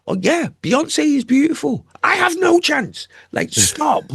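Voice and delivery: squeaky high, swooning and self-mocking; mock lovestruck voice. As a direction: play the hopeless fan, pitched right up. Mocking own crush on [name].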